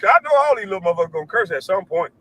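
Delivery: usual speaking voice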